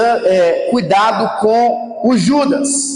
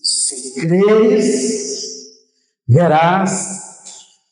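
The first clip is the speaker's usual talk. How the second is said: solemn Jesus; 7 semitones lower, much slower than usual. Acted. Voices Jesus answering Martha, solemnly.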